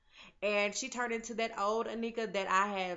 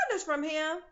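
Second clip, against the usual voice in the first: "Pointers from him?" thrown back much higher and louder; incredulous echo; 7 semitones higher, 4 dB louder than usual. Real, not acted. Throwing Lucius's words back at him, high incredulous echo. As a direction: repeat it like you can't believe it.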